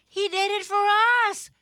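high squeaky protest; small high bunny voice, insistent; little sister pleading. A narrator's reading. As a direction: stand up for big brother. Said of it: "said another".

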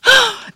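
sharp, high intake of breath; astonished gasp. Acted. Caleb finding himself human, gasping. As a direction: gasp loudly in amazement.

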